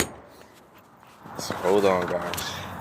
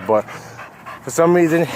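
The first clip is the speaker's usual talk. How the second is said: ordinary talk, nothing marked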